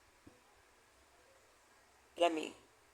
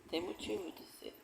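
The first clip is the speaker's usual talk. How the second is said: much softer, almost under the breath; fading to near silence; 19 dB quieter than usual. Real, not acted. Speaker closes her testimony to the audience, fading very softly. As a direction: let the words die away. Repeated closing remark, fading.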